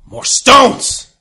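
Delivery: high, loud monster yell; explosive hungry-creature shout. Acted. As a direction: yell the demand as a ravenous beast. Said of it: "Don't yell at me".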